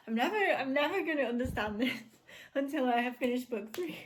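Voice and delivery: high, loud, laughing despair; laughing exasperation. Real, not acted. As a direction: laugh it off while giving up. Laughing at own confusion.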